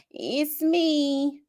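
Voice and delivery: high, drawn-out hello opening, easing down; lightly voiced grandmother on voicemail; grandma's voicemail. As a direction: imitate grandma leaving a message. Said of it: "she'll leave a voicemail".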